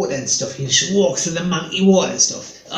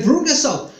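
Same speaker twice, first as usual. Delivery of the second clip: pedantic explaining; 7 semitones higher than usual. Retold or imitated. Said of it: "He said".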